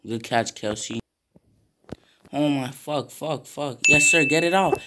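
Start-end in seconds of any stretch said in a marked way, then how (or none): none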